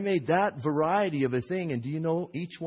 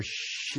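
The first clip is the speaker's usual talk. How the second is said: imitated burner hiss; gas hiss turned up; 5 dB quieter than usual. Potter turning kiln gas up a bit, imitated hiss. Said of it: "turn it up a little bit more".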